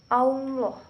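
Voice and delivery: "Allah" with a thick, rounded "au" sound, like "Auloh"; teacher shows the thickened lam in "Allah"; heavy lam. Shown as a demonstration. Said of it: "tapi Allah".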